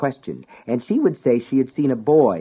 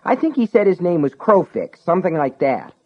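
irritated; 8 semitones higher, 3 dB louder than usual. Acted. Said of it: "sounded irritated".

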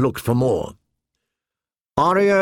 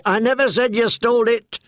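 put-on high-pitched defensive boy's protest; Hot Pie backing off, high and defensive; 7 semitones higher than usual. A narrator's reading. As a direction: raise your voice high, hands up, I didn't accuse you. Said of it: "raised his hands defensively".

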